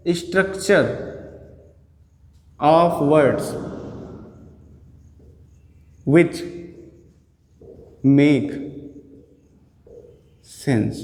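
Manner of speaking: slow dictation, long pause after each chunk; word-by-word dictation. Real, not acted. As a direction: dictate it slowly, piece by piece. (रुक-रुक कर धीमे लिखवाते हुए)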